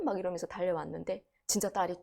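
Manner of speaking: normal talking voice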